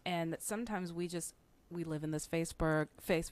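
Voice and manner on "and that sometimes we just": ordinary talk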